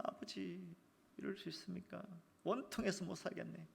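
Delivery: a soft, hushed touch of grief; subdued lament. Acted. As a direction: complain quietly, almost to himself.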